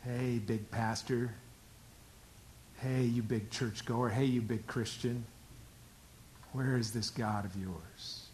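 slow, low, needling jeer; taunting enemy. Acted. Enemy mocking a big pastor and churchgoer, taunting. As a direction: taunt them quietly, drawing it out. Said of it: "The enemy comes along".

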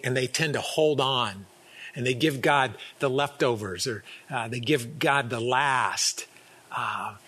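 usual speaking voice

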